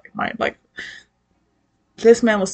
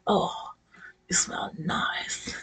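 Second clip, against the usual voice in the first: slow, hushed whisper; mock smitten whisper; 11 dB quieter, slower than usual. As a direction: whisper it slowly, as if breathing in her scent. Blindfolded man sniffing her, acted.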